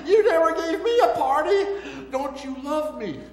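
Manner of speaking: put-on high, loud, aggrieved older-son whine; the older son's wounded complaint to his father, high and whiny. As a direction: plead like the jilted older brother.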